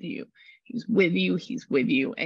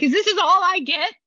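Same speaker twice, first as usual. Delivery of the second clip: high, loud incredulous question; indignant disbelief; 12 semitones higher, 7 dB louder than usual. Retold or imitated. Spirit protesting to the medium in high disbelief. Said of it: "He's like".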